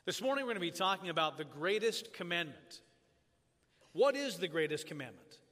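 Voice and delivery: ordinary talk, nothing marked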